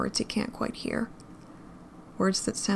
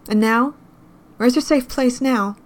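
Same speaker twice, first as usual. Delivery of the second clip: challenging; 4 semitones higher, 7 dB louder than usual. A narrator's reading. Girl challenging the man.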